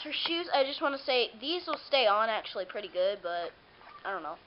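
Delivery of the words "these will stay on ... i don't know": ordinary talk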